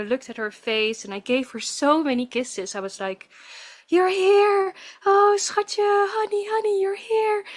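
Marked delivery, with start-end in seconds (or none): 3.9-7.4 high, loud, tearful joy; overjoyed greeting. Retold or imitated. Mother holding her newborn, high overjoyed greeting. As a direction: greet the baby, overwhelmed with love.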